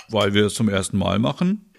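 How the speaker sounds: ordinary talk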